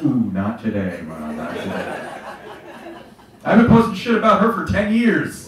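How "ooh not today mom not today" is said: low, slow brush-off to the screen; dodging mom's friend request, muttering no; mock dismissive. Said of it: "I was like".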